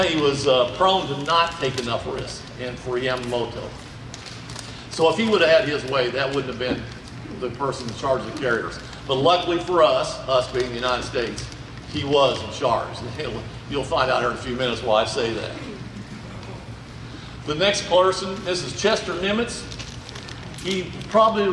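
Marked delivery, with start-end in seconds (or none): none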